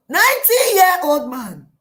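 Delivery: loud, high wail falling away; hammed-up dismay with a falling end; appalled outcry. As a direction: cry it out in horror, pitch dropping at the end. Acting Hagar's dismay at match.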